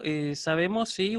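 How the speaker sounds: ordinary talk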